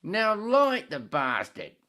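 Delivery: high, loud, slow Aussie demand; Australian accent. Acted. Head demands the bartender light his cigarette, slow loud Australian accent. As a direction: drag it out as a brash Australian drinker. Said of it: "Australian accent keep going into British".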